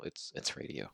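low whispered reminder; whispered aside. Real, not acted. Hushed reminder it's audio only.

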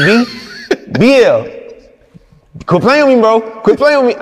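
high, loud half-laughing scold; mock scolding. Thug laughing as he scolds the director. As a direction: scold him like a big brother.

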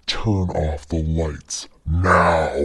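very low, slow demonic voice; mean evil possessed voice. Acted. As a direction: drag it out, deep and evil. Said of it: "talking in a mean and evil way".

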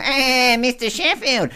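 playful Fran Drescher put-on; Fran Drescher impression. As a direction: do her like Fran in The Nanny. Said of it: "that voice of Fran there".

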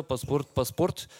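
low, quiet official calling; stern customs officer. Retold or imitated. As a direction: mutter it like a bored officer. Customs officer demanding passports.

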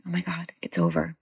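retold in a soft whisper; hushed relief. Mother just after the baby is out, whispered relief.

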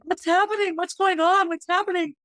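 high, upset crying plea; imitating crying daughter. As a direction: voice a sobbing teenager asking what's happening. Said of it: "she just started crying".

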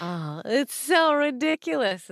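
high, drawn-out exasperation; exasperated disbelief. Real, not acted. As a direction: stretch it out in amused outrage. Mock outrage at brazen denial.

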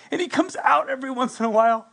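usual speaking voice

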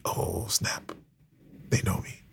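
hushed mock-panicked whisper. Playfully telling listeners about being recognised, in a hushed whisper. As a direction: whisper it like you've been caught.

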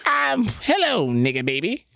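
hesitant, scared go at a silly cartoon character. Scared comedian does his cartoon voice for the boss. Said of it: "I'm gonna do the voice".

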